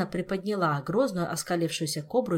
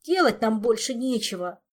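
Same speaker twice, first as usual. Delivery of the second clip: higher, louder cross retort; angry Mafei; 5 semitones higher, 5 dB louder than usual. Acted. Mafei denying any prank, cross and defensive. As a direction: answer back testily. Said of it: "сердито огрызнулся Мафей".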